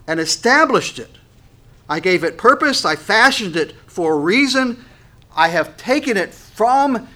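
emphatic, measured declamation, key words punched loud; ringing scripture declamation, stretched stressed words; preached proclamation. Acted. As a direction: proclaim it like God's own decree.

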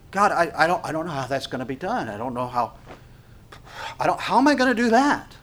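halting protest that climbs into disbelief; flustered doubter. Acted. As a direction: act out someone at a loss before God. Voicing a doubting believer to God.

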